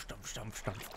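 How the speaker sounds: ordinary talk, nothing marked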